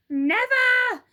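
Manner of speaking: put-on high, loud, upset old wife; Mrs Twit crying out. Old wife cries out to her husband, high and alarmed. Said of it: "she cried".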